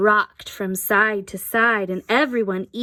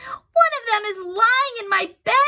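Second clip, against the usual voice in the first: much higher, crying out; boy yelling; 13 semitones higher than usual. A narrator's reading. Boy yelling at the window about his bed. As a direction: yell it high like an upset boy. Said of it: "yelled William".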